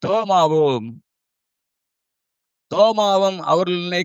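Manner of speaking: slow, solemn phrase-by-phrase reading; scripture reading. Acted. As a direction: read the verse out slowly with long pauses. Reads scripture aloud to congregation. (நிதானமான வேத வாசிப்பு)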